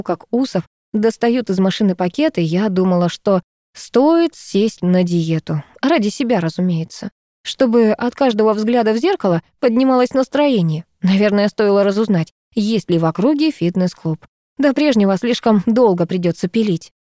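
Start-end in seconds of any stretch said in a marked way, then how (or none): none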